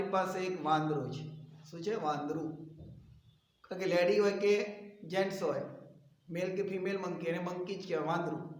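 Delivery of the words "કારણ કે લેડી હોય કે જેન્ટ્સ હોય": ordinary delivery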